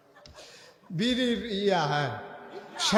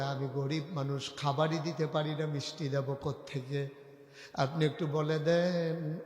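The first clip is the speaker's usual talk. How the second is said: soft sermon storytelling; 2 semitones lower, 6 dB quieter than usual. Acted. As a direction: tell it gently in an even lilt. Preacher intones story in soft voice.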